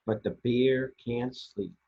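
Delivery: ordinary delivery, nothing marked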